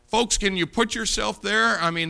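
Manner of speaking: usual speaking voice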